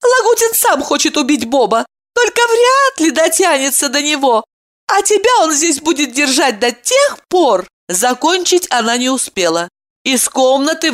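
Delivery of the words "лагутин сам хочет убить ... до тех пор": lightly higher woman voice; woman's voice. A narrator's reading. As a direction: voice her with urgency. Evdokia voiced in story.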